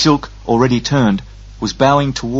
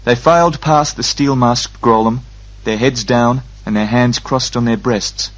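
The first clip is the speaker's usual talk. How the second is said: ordinary delivery, nothing marked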